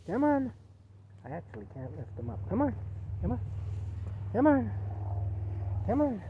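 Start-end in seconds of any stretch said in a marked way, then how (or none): 0.1-0.5 loud high call. Real, not acted. Owner calling her ignoring dog, loud high call. 2.5-6.2 calling out. Real, not acted. Calling dog to come.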